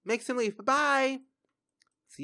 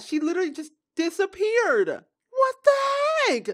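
upset; 9 semitones higher, 4 dB louder than usual. Real, not acted. Shocked by Sim's death.

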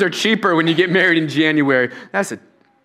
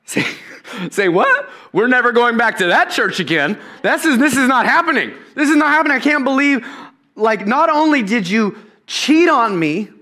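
outraged Joe; 6 semitones higher than usual. Retold or imitated. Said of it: "And Joe's like".

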